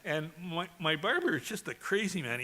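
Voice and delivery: ordinary talk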